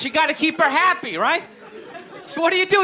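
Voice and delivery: ordinary talk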